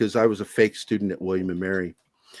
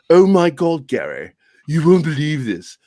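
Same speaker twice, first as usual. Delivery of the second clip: put-on English accent, raised at first; 4 dB louder than usual. English friend calls Gary about the yearbook, imitated English accent. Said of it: "Yeah, she's English".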